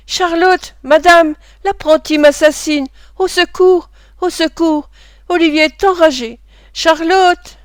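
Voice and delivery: high, loud panicked calls; shouting for help. A narrator's reading. Noé screams to Charlotte and the mistress for rescue. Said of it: "criait Noé".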